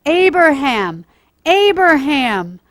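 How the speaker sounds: loud, slow calling of the name; urgent heavenly call, drawn out; angel calling out. Acted. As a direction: call down from the sky to stop him. Angel calls out to Abraham.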